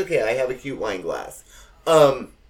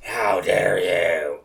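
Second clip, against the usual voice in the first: slow, lower, scandalised snub; mock haughty elder; 6 semitones lower, 4 dB louder, much slower than usual. The cousin snubbing her in her own house, mock haughty. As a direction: look down your nose at her. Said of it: "gave me this look of like".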